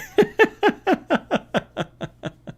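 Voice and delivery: cracking up at the chat; high burst of laughter. Real, not acted. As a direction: break into a high helpless laugh. Laughing hard at the commute.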